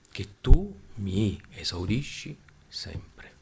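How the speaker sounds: word by word, drawn out; slow, deliberate repeat. Shown as a demonstration. Preacher repeating Jesus' prayer to the congregation, slowly and deliberately. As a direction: spell it out slowly, pausing between words.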